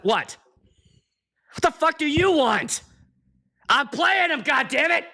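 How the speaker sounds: high-pitched comic fury; hammed-up indignant retort; exasperated outburst. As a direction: snap at someone pestering you, voice climbing. Retells snapping at interrupting lady.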